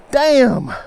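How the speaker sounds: high, loud exasperated curse; frustrated curse. Real, not acted. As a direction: spit it out in frustration. Frustration after losing fish.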